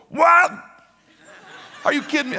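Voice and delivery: high, loud shout of disbelief; incredulous outburst. Retold or imitated. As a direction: cry out like an outraged kid in a cinema. Kid stands up in theater, outraged.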